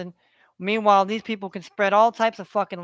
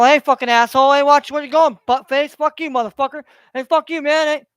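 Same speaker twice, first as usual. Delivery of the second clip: loud, raised-pitch tirade of insults; abusive cussing; 5 semitones higher, 7 dB louder than usual. Acted. Cussing at somebody to get a response, loud and aggressive. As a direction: hurl insults like a street confrontation. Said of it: "you downgrade people and you talk shit to 'em".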